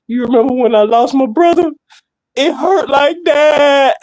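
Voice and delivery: high wail, stretching into a sob; mock crying. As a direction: imitate a heartbroken friend bawling. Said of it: "He was crying too much".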